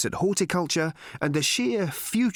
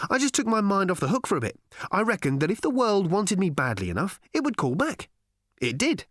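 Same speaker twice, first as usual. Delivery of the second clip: high, quick, casual chat; breezy Ford; 7 semitones higher than usual. A narrator's reading. Ford explains his absence to Arthur, breezy and high. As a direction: explain it airily, pitched up. Narrator voices Ford's breezy reply.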